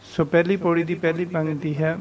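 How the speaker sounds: usual speaking voice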